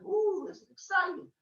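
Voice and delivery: high-pitched delighted ooh; fascinated. Acted. Young Hermes watching adults yell back and forth, fascinated. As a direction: lift it high, like a thrilled kid. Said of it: "And he's fascinated".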